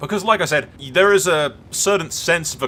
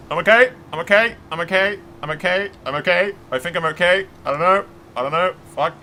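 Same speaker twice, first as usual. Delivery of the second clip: shaken; 5 semitones higher, 4 dB louder, faster than usual. Real, not acted. After the crash.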